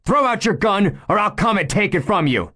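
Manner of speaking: high, loud, shouted order; commanding shout. Acted. Chief shouting at the gunman to throw out his gun, loud order. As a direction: command him at full voice.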